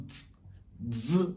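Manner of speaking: "z" with a T before, like "ts"; Chinese z. Shown as a demonstration. Teacher showing Chinese z to learners, soft. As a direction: click a "dz" for "z". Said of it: "comme s'il y a un T avant le Z".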